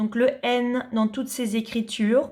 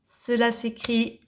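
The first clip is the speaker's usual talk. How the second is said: ordinary delivery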